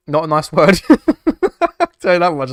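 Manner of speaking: loud, cracking up mid-phrase; laughing. Real, not acted. Streamer dodging a rude word's meaning, laughing loudly. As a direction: burst into laughter through the words.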